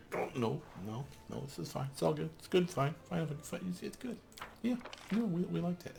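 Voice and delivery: high strained this-is-fine; high tense reassurance to herself; forced calm. Real, not acted. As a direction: talk yourself down through gritted teeth. Forcing calm after a failure.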